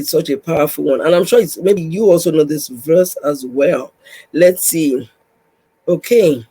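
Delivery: usual speaking voice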